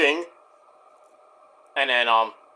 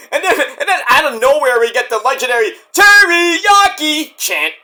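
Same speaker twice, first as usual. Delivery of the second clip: excited; 8 semitones higher, 5 dB louder than usual. Real, not acted. Excited hype.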